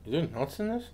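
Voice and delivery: talking between bites; chewing while talking. Real, not acted. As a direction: mouth partly full. Seen 0: Left man's hand is at his mouth, jaw chewing through the words.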